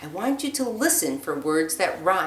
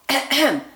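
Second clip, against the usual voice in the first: a small throat-clearing cough, higher; little cough; 7 semitones higher, 5 dB louder than usual. Acted. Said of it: "No one heard him cough".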